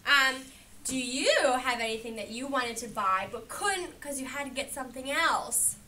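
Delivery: usual speaking voice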